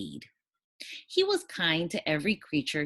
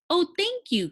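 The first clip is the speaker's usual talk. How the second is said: much higher, grateful griffin cry; delighted cry; 4 dB louder than usual. Acted. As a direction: gush your thanks up high. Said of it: "cried the griffin".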